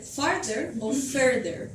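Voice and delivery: slow and careful, each word set apart; teacher spelling out options slowly; slow contrast. Shown as a demonstration. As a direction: lay out both forms slowly for students. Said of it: "teacher contrasts two spellings".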